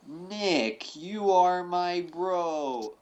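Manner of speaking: very slow, drawn-out mock clip; slowed-down sound clip. Host playing [name]'s sound bite as evidence, slowed down. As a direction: stretch every word out as if played slowed down. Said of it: "we're gonna slow it down for you".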